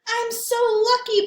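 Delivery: high, loud sing-song delight; sing-song joy. Acted. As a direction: sing it out happily. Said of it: "Ball said".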